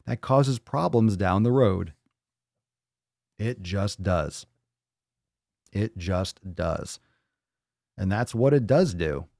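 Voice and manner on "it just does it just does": slow emphatic. Real, not acted. Emphatic closing point, repeated.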